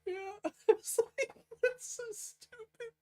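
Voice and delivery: very high voice squeezed by laughing; helpless laughter. Real, not acted. Admitting own joke is stupid, helplessly laughing. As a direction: wheeze it out, still cracking up.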